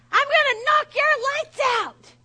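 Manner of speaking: high, full-voiced shout of a threat; loud, high-pitched outburst; outraged yell. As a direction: holler it furiously at the other driver. Child outraged at other driver.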